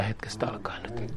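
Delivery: hushed, intense whisper. Real, not acted. Preacher whispers Paul's goal to the church. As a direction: lean in and whisper the point.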